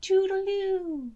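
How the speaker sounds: high slow creature goodbye; singsong farewell. Acted. Creature waving the shepherd off, high singsong. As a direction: sing it off as you leave.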